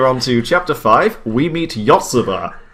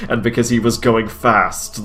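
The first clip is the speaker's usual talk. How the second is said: usual speaking voice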